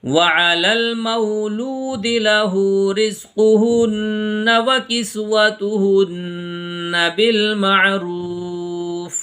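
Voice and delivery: slow, drawn-out melodic tajweed chant; Quran recitation. Acted. Teacher reciting verses for students.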